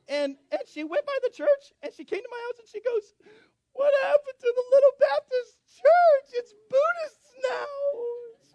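very high, loud mock wail; hammed-up upset in a cartoon-mouse voice; distraught squeaky receptionist. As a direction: mimic her wailing, stretching the last words. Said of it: "She was all messed up about it".

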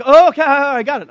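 loud, high imitation of an excited child; overeager kid. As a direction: blurt it like a cocky little boy. Imitates eager young son trying whip.

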